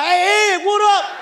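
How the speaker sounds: high, loud call from a passing car; loud, excited fan shout. Retold or imitated. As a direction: holler a greeting at a celebrity. Fans calling out from cars.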